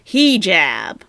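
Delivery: Hijab with stress on a long first syllable, HEE-jab; loud HEE in Hijab, mocking the mistake; mispronounced hijab. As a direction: imitate a learner saying HEE-jab for Hijab. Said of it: "She can't even pronounce hijab correctly".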